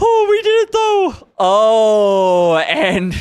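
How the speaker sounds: very high delighted squeal; excited squeal. Real, not acted. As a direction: squeal with victory. Victory over dungeon.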